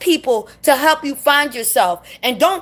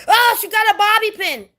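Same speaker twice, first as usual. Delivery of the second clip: higher-pitched mock alarm; put-on fretful protest, pitched up; mocking excuse; 7 semitones higher than usual. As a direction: mimic someone making a feeble excuse. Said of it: "And you can't say".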